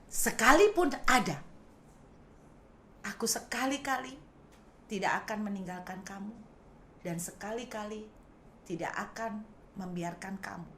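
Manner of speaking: quiet, tender promise of God; soft promise. Retold or imitated. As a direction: comfort them gently in God's voice. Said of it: "Tuhan juga jawab gini".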